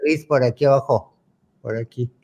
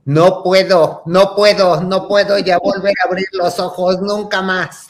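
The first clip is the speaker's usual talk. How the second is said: loud, higher retold wail; despairing refusal; 4 semitones higher, 4 dB louder than usual. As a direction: melodramatic child giving up for good. Child refusing to open eye.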